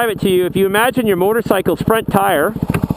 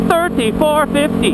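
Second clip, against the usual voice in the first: put-on higher, slow Indian accent bit; a quick impression of a stand-up's Indian accent; imitating Russell Peters' Indian accent; 9 semitones higher than usual. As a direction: quote the comedy routine in a mock Indian accent. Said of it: "he does a, uh, stand-up routine called".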